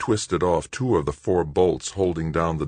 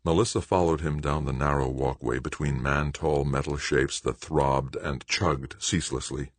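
plain reading voice

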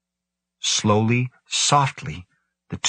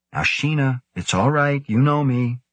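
higher, light coaxing tone; light reassurance; 6 semitones higher than usual. A narrator's reading. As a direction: soothe a big animal lightly. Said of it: "and said lightly".

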